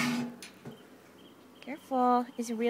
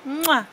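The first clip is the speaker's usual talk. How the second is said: short loud smack; quick kiss for the baby to copy; punchy mwah; kiss sound; 2 semitones lower, 6 dB louder than usual. Shown as a demonstration. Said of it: "Blow mama kisses".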